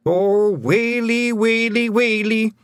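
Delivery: high, slow mock lament; Feegle wail. A narrator's reading. Twiddler wails at news of the queen, high and slow. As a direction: wail it like a keening clansman.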